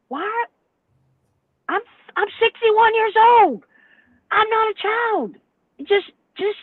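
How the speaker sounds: pleading to be treated like an adult; high exasperated rant at dispatchers; exasperated outburst. Real, not acted. Ranting at the trackers.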